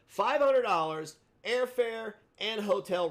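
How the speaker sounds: loud, high, drawn-out offer; announced challenge. Real, not acted. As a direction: proclaim the prize like a promoter. Announces the fight offer's terms.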